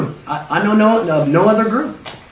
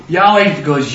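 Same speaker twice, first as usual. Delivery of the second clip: ordinary talk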